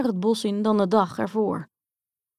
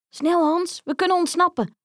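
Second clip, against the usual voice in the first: much higher, urgent and eager; urgent girl's call; 9 semitones higher, 3 dB louder than usual. Acted. Grietje urges Hans to flee, high and urgent. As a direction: hurry him out. Escape through the hole.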